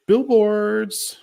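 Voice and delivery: high, punchy mock quote of Tony; eager answer. Imitating Tony's eager answer about billboards, high and punchy. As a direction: blurt the word out high and bright.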